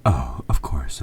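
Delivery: ordinary talk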